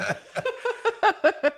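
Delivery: a sudden high burst of real laughter. Real, not acted. Laughs at flirty remark.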